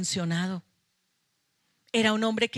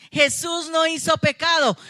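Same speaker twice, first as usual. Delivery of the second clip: shouted, higher and louder, with NO hit hard; preacher's shout; 7 semitones higher, 5 dB louder than usual. Acted. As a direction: proclaim it at full force to the congregation. Predica el punto central. (la predicadora grita la verdad a la congregación)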